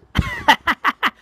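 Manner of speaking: very high staccato laugh; cartoon laugh. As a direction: laugh in bursts, high in character. Punchy laughing at comedies.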